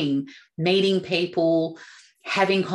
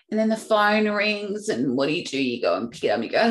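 usual speaking voice

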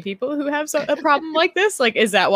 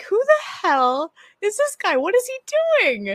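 slow, higher puzzled asking; confused; 9 semitones higher, slower than usual. Retold or imitated. Viewer puzzling over the man in the intro, slow and baffled. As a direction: ask slowly, baffled. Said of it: "you're like".

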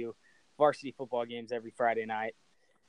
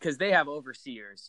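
normal talking voice

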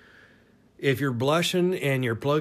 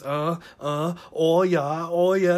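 building, louder mimicry in Northeastern accent; mock Maine-surfer accent; 4 semitones higher, 4 dB louder than usual. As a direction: imitate her getting into it, Maine accent. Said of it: "because she gets into it".

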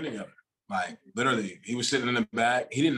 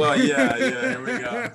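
much higher, louder, giggly yeah; laughing agreement; 13 semitones higher, 6 dB louder, slower than usual. Real, not acted. To the host, joining the Mexico joke, laughing high. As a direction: squeal along with the joke, laughing.

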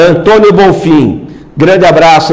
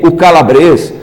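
usual speaking voice

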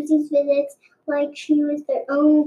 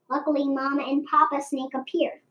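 ordinary delivery, nothing marked

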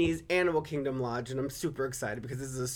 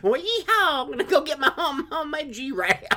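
mock Texan cowboy; 11 semitones higher, 6 dB louder than usual.

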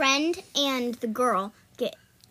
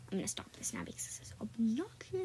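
near-whisper, much softer; very quiet, breath-only confession; hushed aside; 3 semitones lower, 13 dB quieter, faster than usual. Real, not acted. Dropping the act in an aside.

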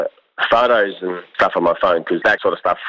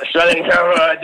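higher, quicker repeat, still slurred; acted-out embarrassed admission, pitched up; reluctant repeat; 8 semitones higher than usual. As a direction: say it up higher, wanting it over with. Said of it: "No, what was that?".

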